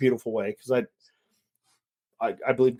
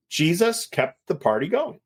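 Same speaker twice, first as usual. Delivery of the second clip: ordinary delivery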